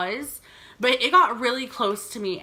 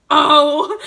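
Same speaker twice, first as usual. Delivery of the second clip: sudden loud high Oh of surprise; surprised; 5 semitones higher, 12 dB louder than usual. Retold or imitated. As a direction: caught off guard, gasp it out. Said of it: "she's like".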